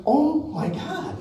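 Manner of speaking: a slow, laughing gasp of shock; stunned disbelief. Retold or imitated. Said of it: "I'm like".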